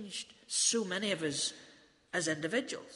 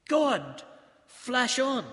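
put-on Guguletu accent; 4 semitones higher, 4 dB louder, much slower than usual. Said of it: "in that wonderful accent of his".

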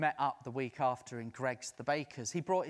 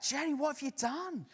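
high alarmed question to Jenny; raised, startled telling-off; mock alarm; 12 semitones higher than usual. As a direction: pretend shock at the volunteer. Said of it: "I was like".

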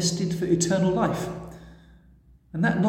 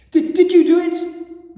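desperate accusing plea; 13 semitones higher, 8 dB louder than usual. A narrator's reading. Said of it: "Mary asked".

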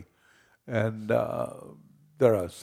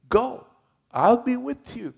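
much higher, loud urging call; God commanding; 13 semitones higher, 4 dB louder than usual. Retold or imitated. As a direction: push him out the door, high and loud. God's command, voiced by preacher.

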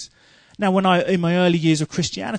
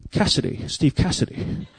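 Bond-style introduction; 2 semitones lower, 5 dB quieter than usual. Retold or imitated. Preacher answering "what's your name" in a soft Bond style. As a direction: breathe your name like a spy. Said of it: "trying to copy him".

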